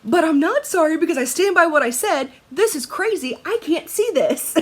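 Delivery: high, loud mock-defiant insistence; defiant take-back. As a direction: playfully stand your ground. Playfully retracting the apology.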